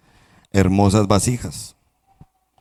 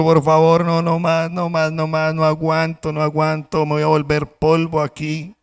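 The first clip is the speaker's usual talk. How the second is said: higher, desperate pleading; desperate cup; 5 semitones higher than usual. Acted. Cup trapped in the oven begs to get out, higher and desperate. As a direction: beg as if about to crack.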